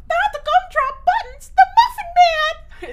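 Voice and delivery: very high, loud squeaky cookie; slow, high, squealing cartoon voice; Gingerbread Man voice. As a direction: shriek it like the tiny Shrek cookie. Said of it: "the gingerbread man from Shrek".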